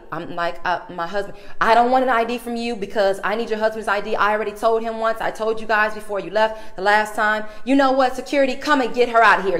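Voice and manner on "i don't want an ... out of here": loud, snippy front desk lady yelling; retold clerk shouting for security; imitated rude clerk. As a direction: bark orders at her like a hostile clerk. Clerk escalates, calls security.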